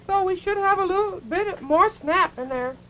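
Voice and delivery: normal talking voice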